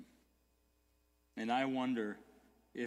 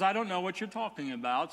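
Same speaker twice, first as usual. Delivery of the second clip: playing ignorant; 6 semitones higher, 6 dB louder, faster than usual. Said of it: "so he just played ignorant".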